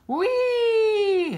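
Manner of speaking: long very high whee; gleeful yell. A narrator's reading. A frog child finally riding his bike, long gleeful whee. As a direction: sustain a joyful squeal. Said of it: "He yelled".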